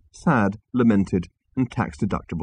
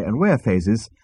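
ordinary delivery

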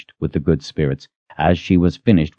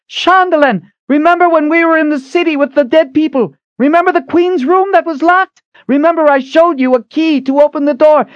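shouting; 4 dB louder than usual. Acted. Shouting instructions from the pit.

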